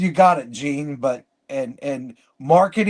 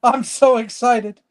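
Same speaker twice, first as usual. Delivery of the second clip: high, hammed-up giddy excitement; mock excitement; 8 semitones higher, 3 dB louder than usual. Voter told he is eligible, mock excitement. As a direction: play overexcited in a raised pitch.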